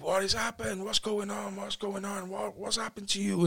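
mock-frantic high voice, no real volume; retold panicked scream. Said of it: "and she really screamed".

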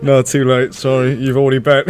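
talking through laughter; teasing while laughing. Real, not acted.

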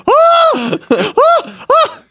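very high-pitched comic shrieks; short bursts of pretend agony screams; mock screaming. Dude sitting in flames in hell, mock screams.

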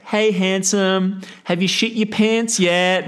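high, slow teasing sing-song call; a high drawn-out mock taunt down the phone; tipsy girlfriends prank-calling to mock him; mocking drunk girls. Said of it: "and basically say".

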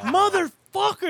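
high, slow drawn-out "Motherfucker"; exasperated groan. Retold or imitated. Kid stuck playing his drunk dad, exasperated and drawn out. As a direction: stretch it out high in disbelief. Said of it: "And I'm like".

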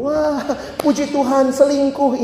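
high, drawn-out gleeful thanks; mock praise. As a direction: act out a cheater's delighted relief. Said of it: "Ada orang gitu".

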